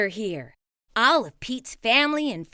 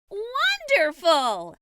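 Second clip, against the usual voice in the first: slow, higher, admiring mom; 6 semitones higher, slower than usual. A narrator's reading. Mom admires Pete's souvenirs, slow and pleased. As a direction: draw it out with delight. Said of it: "says Mom".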